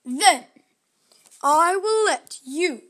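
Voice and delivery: slow, loud, booming ogre. Acted. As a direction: lay down the tests like a huge ogre. Ogre sets her three tests.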